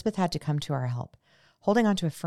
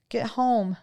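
meek sniffle; 8 semitones higher, 5 dB louder than usual. A narrator's reading. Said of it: "they meekly sniffed".

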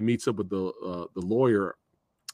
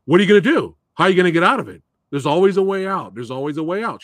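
loud, high, pressing challenge; intense challenge; 8 semitones higher, 8 dB louder, much faster than usual. Retold or imitated. Instructor pushing the scared student to escape, loud and high. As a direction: bark the questions at her, daring her to fight. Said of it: "And he's like".

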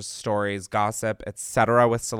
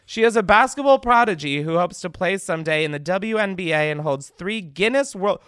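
ordinary talk, nothing marked

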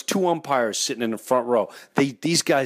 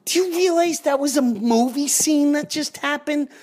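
amazed; 13 semitones higher than usual. Retold or imitated. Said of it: "I'm like".

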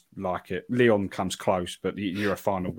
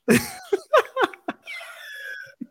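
high, teary laugh that breaks into crying; real emotional laugh-cry, overwhelmed; laughing through tears; 6 dB louder than usual. Real, not acted. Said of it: "I'm a complete mess".

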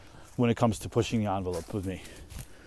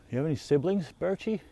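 lightly raised, chatty voice for a tree; playful talk to a tree; 5 semitones higher, 4 dB quieter than usual. Real, not acted. Talking to a fallen birch, playful and lightly raised. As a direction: tease the birch like a pet.

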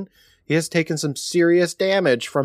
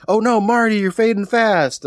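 mock alarm; 9 semitones higher, 4 dB louder than usual. Recapping Marty's flashback collapse, in mock alarm. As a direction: wail it in hammed-up dismay.

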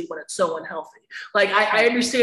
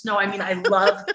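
ordinary talk

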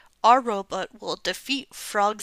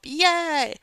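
excited cheer; 6 semitones higher than usual. Acted. Child cheering for lollipop.

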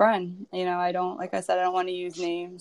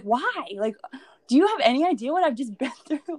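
high, pleading disbelief with a laugh breaking through; incredulous protest; 6 semitones higher than usual. Retold or imitated. Newcomer pleading with the laughing circle, high incredulous disbelief. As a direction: ask it as if they must be mad.

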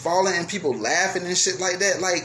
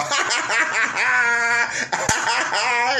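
mocking laugh; 5 semitones higher than usual. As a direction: laugh at him as he falls. Crowd laughing at the fall.

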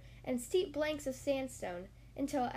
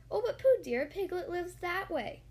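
higher, lightly motherly Kanga; a kindly correction in a raised storybook voice; gently setting Pooh straight, pitched up; lightly voiced Kanga; 5 semitones higher, 4 dB louder than usual. A narrator's reading.

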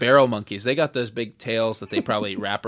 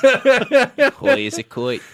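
slow, high, laughing echo of the quote; laughing repeat; 10 semitones higher, 4 dB louder, much slower than usual. Real, not acted. Friend echoing the judge's quote, laughing slowly. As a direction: drag it out through the giggles.

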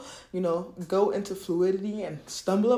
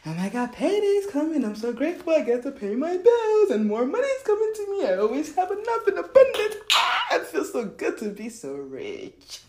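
high-pitched, gushing joy; grateful excitement; 7 semitones higher than usual. Shown as a demonstration. Showing how to greet payday, gratefully thrilled. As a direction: gush it, climbing higher with delight. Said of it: "Or should you say".

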